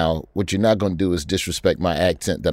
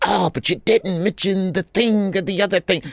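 high-pitched mock complaint; mock nitpicking; 9 semitones higher than usual. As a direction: imitate a viewer whining about omissions. Mimics a nitpicking viewer.

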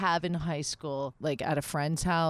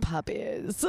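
a hint of Cruella's accent; lightly voiced villainess; 3 semitones lower than usual. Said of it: "I might have did a little of the accent".

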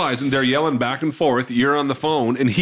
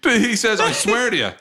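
raised, high comic insistence; emphatic retelling; 8 semitones higher than usual. Retold or imitated. Comedian's son retelling his father's denial, emphatic insistence. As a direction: insist with the pitch pushed up.

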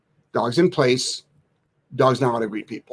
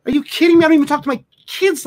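higher, louder incredulous outburst; exasperated outburst; 12 semitones higher, 3 dB louder, faster than usual. Retold or imitated. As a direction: fire it off in disbelief. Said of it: "It's like".

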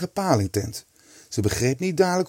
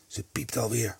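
hushed, softer murmur; whispered; 2 semitones lower, 7 dB quieter than usual. A narrator's reading. Kees telling his mates she is asleep again, whispered. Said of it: "fluisterde hij".

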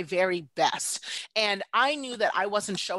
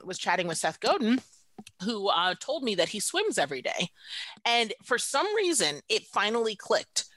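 ordinary talk, nothing marked

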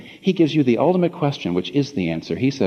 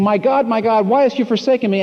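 higher and louder anguished cry; 11 semitones higher, 4 dB louder than usual. Retold or imitated. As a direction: call out the words of the cross in anguish. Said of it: "the cry of the cross".

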